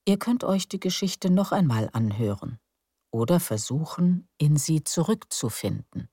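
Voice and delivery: plain reading voice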